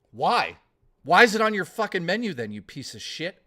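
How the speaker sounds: real irritated rant, loud and quickening; a little angry. Real, not acted. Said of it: "I'm getting a, a little angry".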